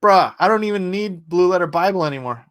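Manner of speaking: ordinary delivery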